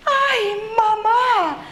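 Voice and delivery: high, drawn-out wail for mama; frightened cry. Acted. Brother and sister mice hide behind mama, frightened cry. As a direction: call out to mama in alarm.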